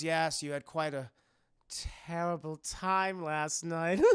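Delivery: slow, deliberate villain voice; creepy, measured bedside manner; sinister host. Retold or imitated. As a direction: draw out the false sympathy. Voicing Howard to his groggy victim.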